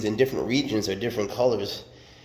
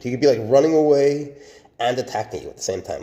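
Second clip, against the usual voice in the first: usual speaking voice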